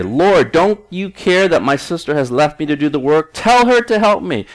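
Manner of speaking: a sister fed up with doing all the work; agitated complaint. Acted. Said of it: "Mary is agitated".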